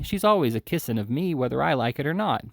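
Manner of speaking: ordinary delivery, nothing marked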